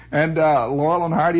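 plain reading voice

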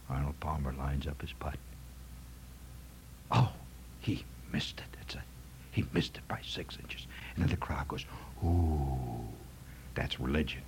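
breathless low whisper of a golf announcer; whispered commentary. Retold or imitated. Comic showing the golf commentator's reverent whisper. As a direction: whisper it as if not to disturb the putt. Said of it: "they speak in a hushed voice".

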